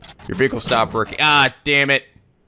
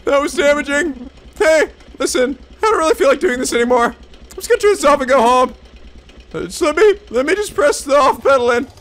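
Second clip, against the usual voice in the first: higher-pitched, hurried excuse-making; flustered quitter; 12 semitones higher, 4 dB louder than usual. Acted. Driver wanting to switch off and go home, flustered. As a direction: back out quickly in a raised voice.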